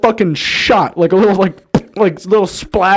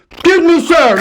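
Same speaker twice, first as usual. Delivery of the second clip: higher, louder toothless call; toothless old lady; 9 semitones higher, 4 dB louder than usual. Retold or imitated. Toothless stranger calling out to him, higher and louder. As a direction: flap the lips like a woman with no teeth. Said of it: "kinda flapping her lips at me".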